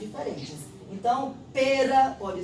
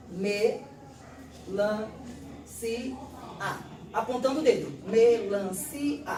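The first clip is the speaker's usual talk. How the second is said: syllable by syllable; 3 semitones lower than usual. Shown as a demonstration. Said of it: "ele pode ler melancia assim, ó".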